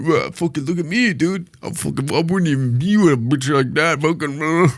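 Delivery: put-on voice of a blustering braggart; mock your own tough talk; mocking big talker. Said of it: "I'm talking like a big game".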